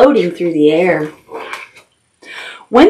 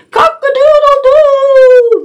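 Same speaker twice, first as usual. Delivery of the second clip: much higher, louder, slow crowing; rooster crow; 4 dB louder, slower than usual. Acted. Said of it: "The red-feathered rooster crows".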